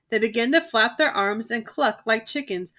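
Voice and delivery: usual speaking voice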